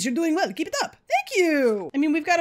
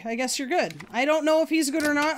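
ordinary talk

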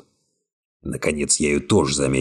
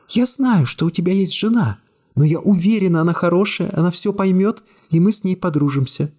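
put-on lightly raised young woman voice, cool and calm; young woman's voice; 13 semitones higher than usual. A narrator's reading. Secretary coolly refusing the hero's embrace, high young woman voice. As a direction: voice her higher, calmly distant.

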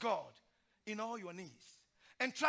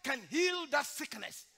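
usual speaking voice

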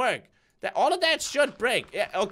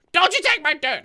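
loud, higher playful threat; loud warning; 4 semitones higher, 7 dB louder than usual. Acted. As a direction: warn it off loudly. Warning a mob over dirt.

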